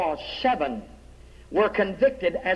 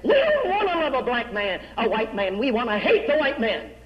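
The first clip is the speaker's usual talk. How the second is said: high mocking jeer with a laugh; jeering crowd; 4 semitones higher than usual. Crowd mocking the evangelist Dubie, high jeering voice. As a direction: sneer it at the preacher, half laughing.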